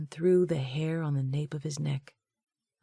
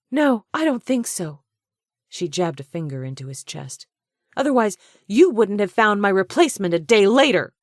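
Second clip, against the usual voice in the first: high, loud jabbing accusation; fiery; 5 semitones higher, 9 dB louder than usual. A narrator's reading. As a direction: jab each word like a finger. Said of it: "eyes sparking fire".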